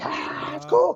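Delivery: very high, airy mock crowd; hammed-up crowd; crowd reaction. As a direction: coo like an impressed audience.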